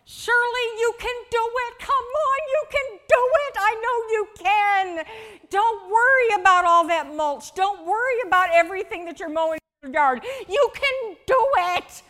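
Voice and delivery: high, loud, fast cheering for a friend; hammed-up cheerleading, bouncing on every word; cheerleader. Preacher mocking a cheerleader friend, shrill loud cheering. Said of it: "Encouragement is not me going".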